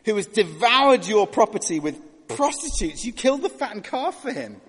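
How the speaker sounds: high, loud indignant complaint; resentful older brother. Retold or imitated. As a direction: voice the older son's bitter outrage. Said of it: "He says to the father".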